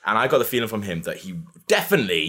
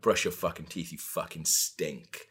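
retold hostile insult; 4 semitones lower, 8 dB quieter than usual.

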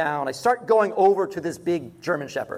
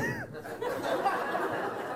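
laugh; 11 dB quieter than usual. Real, not acted. Laughing at his own story.

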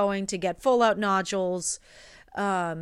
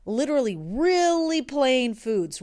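higher and louder, pressing on plain; emphatic plain foods; 6 semitones higher, 7 dB louder than usual. Real, not acted. Telling friends about her bland diet, emphatic. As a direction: lean hard into how plain it was.

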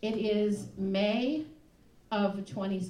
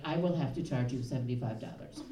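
low, stern imitation of the driver; mock-stern West African driver; 8 semitones lower, 4 dB quieter than usual. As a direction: lay down the penalty gravely, in a lowered voice. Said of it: "he said to me very sternly".

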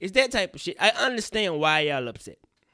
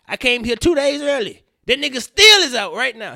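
louder and higher, fed up; frustrated outburst; 8 semitones higher, 12 dB louder than usual. Real, not acted. Telling listeners R. Kelly is free, loud and fed up. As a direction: vent it loud.

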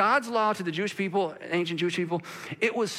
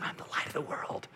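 hushed; 4 semitones lower, 10 dB quieter than usual. Acted. Quoting Jesus softly.

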